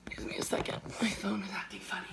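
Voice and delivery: whispered quick aside; whispering. Real, not acted. Aside about phone mid-demo.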